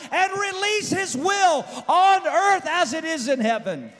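high, loud preaching climax; preacher shouting to the crowd. Acted. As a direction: build to a roaring revival shout.

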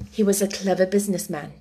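plain reading voice

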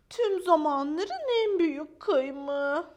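high, loud, slow proclamation; grand boast. Acted. Maya brags to Rex about her splinter, grand boast. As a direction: proclaim it slowly as a record-breaker.

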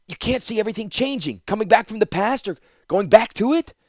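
put-on high, eager disbelieving young man; Ratapi's young voice. Ratapi urging Zancha to see time changing, high and eager. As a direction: press her, amazed she can't see it.

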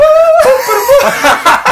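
loud, very high comic cry; high catchphrase yell. Acted. Co-host bursts in with his signature yell, high and loud. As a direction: let out a piercing signature yell. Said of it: "Eu gostei desse bordão do Carneiro".

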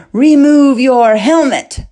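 loud, slow barked order; commanding shout. A narrator's reading. Fleck orders the king to remove the helmet, shouting. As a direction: order him sharply to unmask. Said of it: "Fleck ordered the king".

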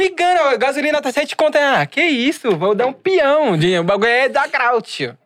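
high, laughing showman voice; wannabe influencer voice. Pretend. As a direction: hype it up like a wannabe star. Mock wannabe influencer after "Artista.

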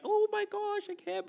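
very high, squealed exaggerated panic; high-pitched gushing, dying away; mock freak-out. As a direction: imitate a dog lover freaking out. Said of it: "and freaking out".

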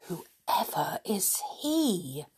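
slow, soft, whispery amazement; hushed wonder. A narrator's reading. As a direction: wonder aloud under your breath. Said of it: "said Mother in wonder".

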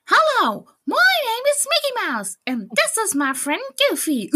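high squeaky cartoon mouse voice; Mickey Mouse voice. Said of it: "Nee, der sagt doch so".

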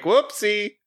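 high, light little oops; mock-casual singled-out high exclamation; breezy whoopsie. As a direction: toss it off high as if nothing happened. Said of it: "you're just like".

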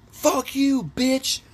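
high, mock furious yell; high-pitched hollered insult, acted out; retold angry yell. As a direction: imitate a driver yelling at the window. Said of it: "yelling at the lady in the fucking window".

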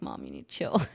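put-on low, deadpan baby talking back; a mock grown-up baby; baby's voice. Newborn daughter telling her crying mom to chill, deadpan low. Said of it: "she was like".

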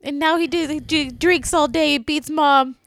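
put-on high, slow, pleading child; little kid voice. Mimicking a child's letter to Santa, high and slow. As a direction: play a small sad kid writing to Santa. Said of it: "It's like a little kid that's like".

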